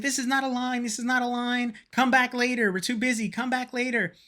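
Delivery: high, flustered imitation of the staff lady; panicking staff lady. Imitating the panicked Japanese staff lady turning people away, high-pitched. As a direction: mimic her frantic insistence. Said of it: "she's, like, panicking".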